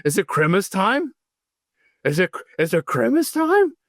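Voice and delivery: an excited dog begging to know if it's Grimace time; Tanner voice. Retold or imitated. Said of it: "I do my Tanner voice".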